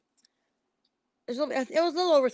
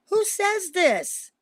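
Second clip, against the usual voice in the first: indignant outrage; 7 semitones higher, 4 dB louder, much slower than usual. Real, not acted. Outraged at the other psychic.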